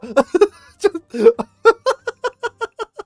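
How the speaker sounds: long high-pitched laugh; loud burst of laughter trailing off; laughing fit. Real, not acted. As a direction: crack up helplessly at your own bit. Cracks up after own impression.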